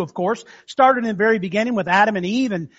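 normal talking voice

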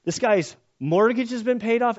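usual speaking voice

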